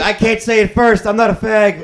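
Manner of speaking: high, loud and laughing; mock defiant refusal. Chicken player refusing to quit first, mock defiant. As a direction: belt out a mock protest through laughter.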